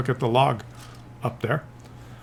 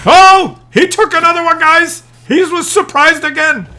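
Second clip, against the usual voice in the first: higher and louder, suddenly thrilled; excited outburst; 7 semitones higher, 5 dB louder than usual. Real, not acted. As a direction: blurt it out in disbelief. Shocked at replay play.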